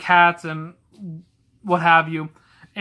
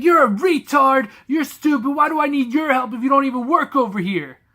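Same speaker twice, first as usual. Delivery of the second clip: higher, louder sneering insults in a put-on voice; mocking the rude customer; 8 semitones higher, 4 dB louder than usual. Retelling how the fat lady insulted the worker, in a higher, louder sneering voice. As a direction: impersonate a nasty customer jeering. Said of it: "She's like, she's like".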